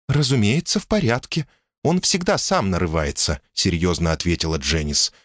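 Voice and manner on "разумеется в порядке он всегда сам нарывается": lightly voiced wife, higher; calm, sensible woman speaking up high; woman's voice.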